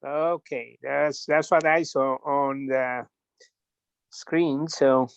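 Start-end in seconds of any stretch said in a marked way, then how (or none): none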